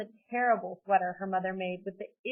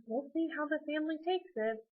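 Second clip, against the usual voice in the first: higher-pitched hedging concession; reluctant; 7 semitones higher, 4 dB quieter than usual. A narrator's reading. Dad agreeing to consult the family, reluctant. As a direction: concede it without committing. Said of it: "Amy's dad said reluctantly".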